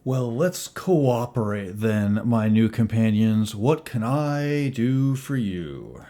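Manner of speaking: slow, drawn-out offer to cooperate; deliberate, measured in-character welcome; [name], mock-obliging. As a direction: stretch the words out with showy courtesy.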